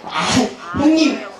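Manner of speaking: ordinary delivery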